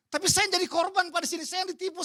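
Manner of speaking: protesting. Retold or imitated. Menirukan orang protes.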